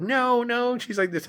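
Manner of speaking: very high mock protest; insistent denial. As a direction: imitate a friend objecting, voice shooting up. Acted argument, friend denies it.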